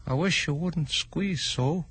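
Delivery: slow, sleepy little complaint; drowsy grumbling dormouse. Acted. Dormouse voiced.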